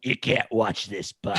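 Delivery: lightly put-on low mom voice; low mock parental order; corrected mother impression. Shown as a demonstration. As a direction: show how she said it, pitched low. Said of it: "you're not doing it right. It's this".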